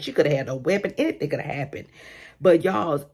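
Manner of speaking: ordinary delivery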